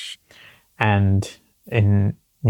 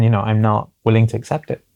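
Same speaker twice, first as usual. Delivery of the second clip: ordinary talk, nothing marked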